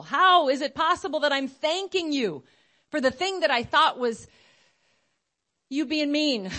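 fervent, emphatic wonder; impassioned amazement. Retold or imitated. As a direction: marvel aloud in prayer, voice lifted high. Impassioned cry to the Lord.

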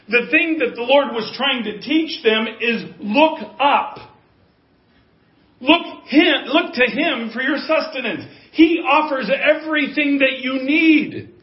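fervent preacher's climax; full-force preaching. Real, not acted. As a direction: preach it out to the room.

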